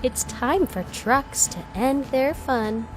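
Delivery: ordinary delivery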